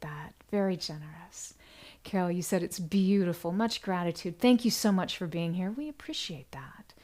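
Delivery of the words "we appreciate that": whisper. Real, not acted. Host thanking donors on livestream.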